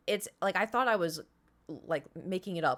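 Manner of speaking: usual speaking voice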